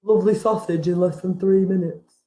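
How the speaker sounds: lightly voiced smug meat eater savouring a sausage; mock meat eater. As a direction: mimic a greedy sausage lover, lightly. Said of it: "'cause you could go".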